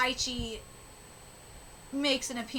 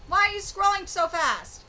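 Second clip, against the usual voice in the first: louder, higher complaint at the machine; annoyed scolding; 6 semitones higher, 4 dB louder than usual. Real, not acted. Scolding a computer that scrolls too fast, irritated. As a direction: demand it like the screen wronged you.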